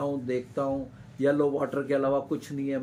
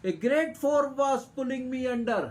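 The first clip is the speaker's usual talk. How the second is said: dramatic reading; 7 semitones higher, much slower than usual. A narrator's reading. Reads English story line dramatically.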